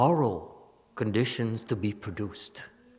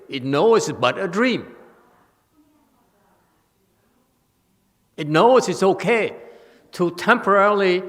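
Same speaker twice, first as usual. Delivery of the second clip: rising to full preaching force, louder and higher on each line; building preaching; 8 semitones higher, 8 dB louder than usual. Acted. Teacher building to a peak for the students, preaching louder and higher. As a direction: hammer each "knows" harder until you ring out.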